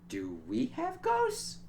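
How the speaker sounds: slow question climbing very high; mock-astonished, pitch soaring at the end; dawning realisation.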